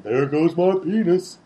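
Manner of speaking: slow, drawn-out catchphrase; lightly voiced TV catchphrase; catchphrase delivery. Quoting a robot sidekick's catchphrase, slow mock delivery. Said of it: "there's a catchphrase".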